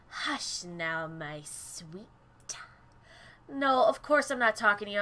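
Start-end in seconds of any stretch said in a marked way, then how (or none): 0.1-2.1 put-on lower, much softer hush to a baby; calm the baby gently; soothing lullaby tone. Mother soothing her baby.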